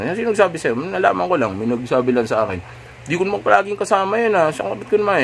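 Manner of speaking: put-on high-pitched defensive girlfriend, caught off guard. Girlfriend dodging a question about Jeffrey, defensive and high. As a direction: push back fast, sounding accused. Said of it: "Yung defensive".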